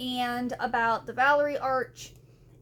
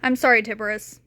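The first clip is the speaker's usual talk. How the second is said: ordinary talk, nothing marked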